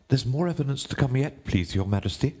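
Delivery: high, deferential courtier. A narrator's reading. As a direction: address the king in a high rabbit voice.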